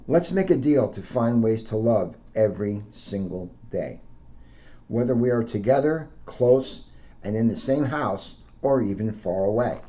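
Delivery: picture-book read-aloud, gently lifted; reading to children. Acted.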